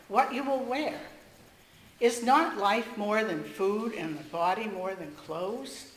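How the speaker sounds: usual speaking voice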